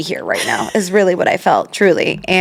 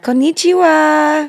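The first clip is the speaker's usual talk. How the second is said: put-on high sing-song child voice; 9 semitones higher, slower than usual. Kids greeting in Japanese.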